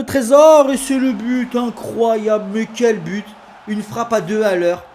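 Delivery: loud, high goal call; excited sports commentary. Acted.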